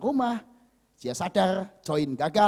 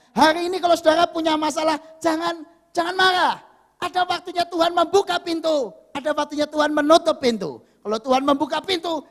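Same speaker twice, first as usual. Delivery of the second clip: loud, raised-pitch sermon proclamation; full-force preaching; 11 semitones higher, 7 dB louder than usual. Real, not acted. Preacher urging congregation not to be angry, proclaiming.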